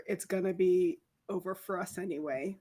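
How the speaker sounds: ordinary delivery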